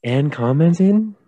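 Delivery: slow, rising incredulous question; mock disbelief. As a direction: stretch it out, pitch climbing in disbelief. Mock disbelief that critic commented.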